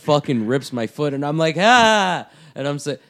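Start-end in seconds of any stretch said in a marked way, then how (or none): 1.6-2.3 high, loud yelp of pain; re-enacted scream, pitched way up; mock pained yell. Grappler reacting as partner rips his foot, pained yell.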